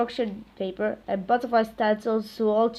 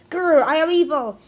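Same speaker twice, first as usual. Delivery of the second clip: hammed evil teacher; 6 semitones higher, 6 dB louder than usual. Comic villain teacher voice.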